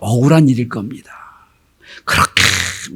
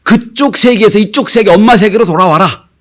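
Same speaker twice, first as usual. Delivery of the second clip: calling out; 6 semitones higher, 5 dB louder than usual. Acted. Voices the summons to return home.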